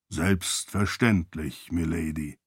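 low, slow, measured voice; deferential attendant. A narrator's reading. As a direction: answer her softly and unhurriedly, sinking at the end. Butler-like aide replying to his lady. (ergebener Diener, tief und langsam)

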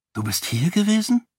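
high, rising disbelief; surprised question. A narrator's reading. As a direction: ask it astonished, voice lifting high. Learns he had been present. (erstaunte, hohe Rückfrage)

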